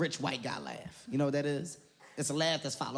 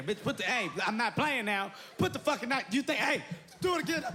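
mock knife standoff; 8 semitones higher than usual. Confronting woman with a knife.

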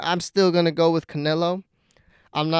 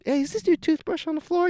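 pitched way up, timid asking; high awkward ask; 12 semitones higher than usual. Retold or imitated. Asking gym strangers about a toothbrush, high and timid. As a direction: squeak the question up high like you feel silly. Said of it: "just picture in your head, me asking".

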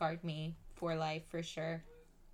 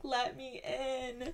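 laughing plea; 7 semitones higher, much slower than usual. Retold or imitated. Banging on friend's apartment door.

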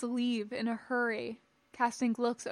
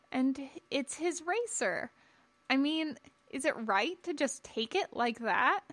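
higher, tentative questioning; hesitant young Padawan; 4 semitones higher than usual. Acted. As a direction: timidly question your master's action.

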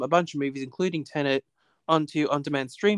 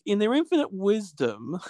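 pitched-up sarcastic emphasis; higher, openly sarcastic jab; 5 semitones higher than usual. Real, not acted. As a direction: say it with mock admiration, before a laugh. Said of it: "you can tell I'm being sarcastic".

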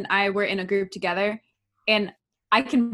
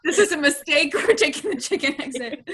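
higher-pitched words through laughter; laughing; 5 semitones higher than usual. Retold or imitated. Friend reliving the chicken exit choice, laughing. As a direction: say it while cracking up.

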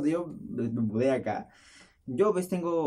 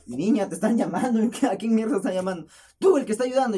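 raised, impatient mock shout; imitated yelling; 6 semitones higher, 5 dB louder than usual. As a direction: imitate a neighbor yelling down from upstairs. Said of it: "de arriba me gritan". (grito impaciente imitando a la vecina)

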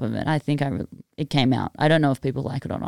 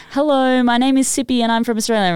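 high, loud bright stage hello; mock-bubbly intro on stage; mic intro; 7 semitones higher, 3 dB louder than usual. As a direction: greet the crowd from the mic, chirpy. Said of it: "I'm like".

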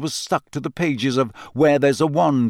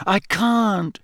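panting; 7 semitones higher than usual. A narrator's reading. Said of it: "Harry panted".

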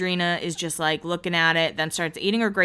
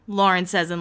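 ordinary talk

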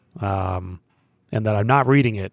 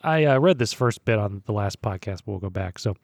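ordinary delivery

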